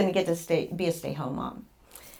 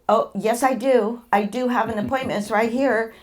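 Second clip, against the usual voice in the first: higher, louder retold insistence; insist, pitched up and pushing; insistent protest; 5 semitones higher, 5 dB louder than usual. Patient argues with receptionist over her appointment, insistent.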